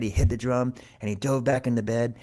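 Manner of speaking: ordinary delivery, nothing marked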